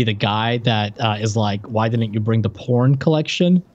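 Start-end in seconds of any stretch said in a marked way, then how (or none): none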